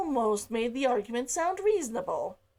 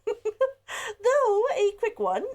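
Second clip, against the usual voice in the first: real laugh through slow, higher words; 9 semitones higher, 4 dB louder, much slower than usual. Real, not acted. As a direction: laugh it out, amused at the short chapter. Laughs after finishing chapter.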